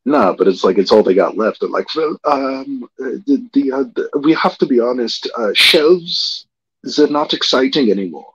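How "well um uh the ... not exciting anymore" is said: lightly put-on German accent, a little higher; hammed-up German-ish Swedish voice; playing a Swedish company exec in a mock German accent. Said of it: "I don't know why I'm doing a German".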